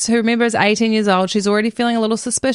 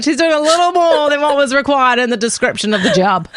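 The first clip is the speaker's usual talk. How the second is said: higher voice through laughter; laughing innuendo; 5 semitones higher, 3 dB louder than usual. Real, not acted. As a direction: say it while cracking up.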